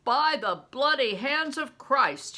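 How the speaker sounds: loud emphatic oath; swearing an oath. A narrator's reading. Bear swearing on Christ's hands to Crispin, loud. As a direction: swear it hard.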